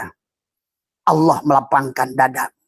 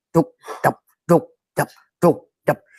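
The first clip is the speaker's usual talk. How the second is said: heartbeat imitation; slower than usual. Shown as a demonstration. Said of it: "jantungmu ... terus berzikir".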